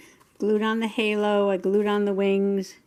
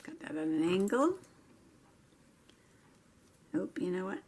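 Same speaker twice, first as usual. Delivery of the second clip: usual speaking voice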